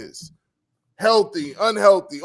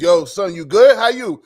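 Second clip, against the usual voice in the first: louder, punchy greeting to a buddy; calling out to a friend; 9 dB louder than usual. Retold or imitated. Checking on his dudes with a loud, friendly call. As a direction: hail a friend across the room.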